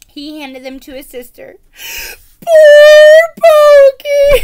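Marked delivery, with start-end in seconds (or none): 2.4-4.4 much higher, very loud, slow mock wail; girl bawls over her broken pet stick, high and loud; bawling. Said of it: "the girl bawled".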